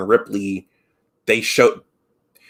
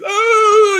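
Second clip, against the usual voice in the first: high, loud imitated crying wail; mock wail; 7 dB louder than usual. Frightened crewmate breaking down, high mock wail. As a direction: let out a high teary oh. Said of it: "you know, like this".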